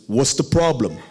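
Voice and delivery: lightly imitated pastor, blunt and matter-of-fact; Pastor Sam's way of talking. Youth pastor brushing off a scared kid, lightly imitated. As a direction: toss it off like the old youth pastor. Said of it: "He talks like that".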